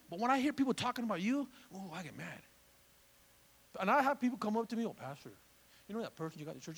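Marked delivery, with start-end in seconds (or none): 4.9-6.8 very soft, low tattletale voice; confiding gossip. Acted. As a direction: murmur it to the pastor like gossip. Voices members gossiping to the pastor.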